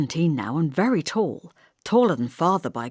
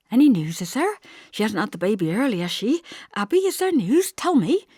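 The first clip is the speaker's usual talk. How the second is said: anxious; 5 semitones higher than usual. A narrator's reading. Anxious mother pressing for news.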